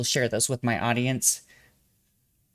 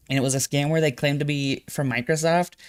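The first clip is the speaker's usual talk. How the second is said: ordinary talk, nothing marked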